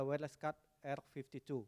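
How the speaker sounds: usual speaking voice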